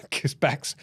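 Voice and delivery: normal talking voice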